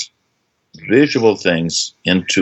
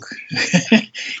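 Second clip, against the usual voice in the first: laugh; 14 semitones higher than usual. Real, not acted. Laughing at his own answer.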